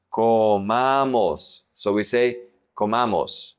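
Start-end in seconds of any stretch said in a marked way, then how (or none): 0.1-1.6 "Comamos" drawn out slowly in three syllables, co-MA-mos. Shown as a demonstration. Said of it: "there are three syllables". 2.8-3.5 "comamos" stressed on the middle syllable, co-MA-mos; stress on second-to-last syllable. Shown as a demonstration. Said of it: "emphasis is always in the second to the last".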